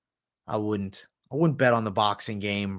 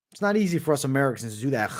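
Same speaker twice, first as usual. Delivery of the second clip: normal talking voice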